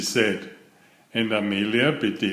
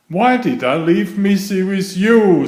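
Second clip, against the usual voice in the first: exasperated scolding; 8 semitones higher, 7 dB louder than usual. Acted. Mother scolds babysitter.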